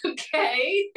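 high, rising, dubious reply; baffled okay. Retold or imitated. Hearing he drowned a mouse, retold baffled reaction.

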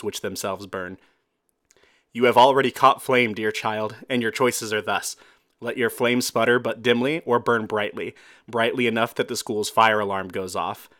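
dramatic poem recital; poem reading. Acted. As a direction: recite it like a slam poet.

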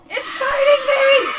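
high, loud screams of pain; agonized screaming. Acted. Mike screaming as the crate creature bites, loud and high. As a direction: shriek as if the monster has your arm. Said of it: "Mike's screams are cut short".

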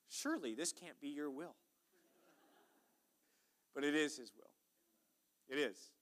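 ordinary delivery

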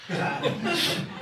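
high, breathless laugh; a soft wheezing laugh; breathy laugh. Real, not acted. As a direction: burst out laughing under your breath. Laughing at the ranger's line.

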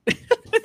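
sudden high giggle; burst of laughter. Real, not acted. Friends cracking up over a vaccine photo, bursting into laughter. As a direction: crack up mid-chat.

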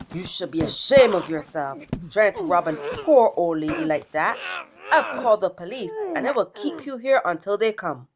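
loud, put-on voice of an indignant neighbor; scolding neighbor. Jesse the neighbor holds the wolf, loud scolding. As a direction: tell off a villain firmly and loudly.

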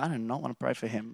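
low, soft, grudging refusal; reluctant refusal. Retold or imitated. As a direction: mutter it like a stubborn Jonah. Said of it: "I'm like".

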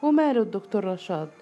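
what-is-wrong-with-him disbelief; accusing of stupidity. A narrator's reading. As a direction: challenge a friend as if she were dim. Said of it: "وكأنها تتهمها بالغباء".

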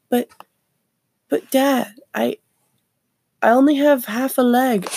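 hammed-up whiny son; mock whining kid. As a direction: play a kid begging his dad. Kid pleading with dad.

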